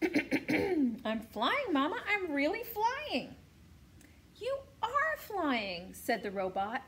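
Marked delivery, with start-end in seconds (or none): 0.0-3.4 excited gosling voice. A narrator's reading. Gosling voice in story. 4.4-5.9 robot voice. A narrator's reading. Robot mother voice.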